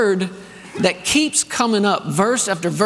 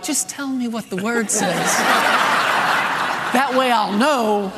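put-on laughing kid plea. Kid's exasperated reply, retold.

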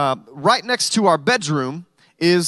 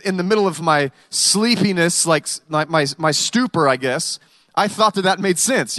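ordinary delivery

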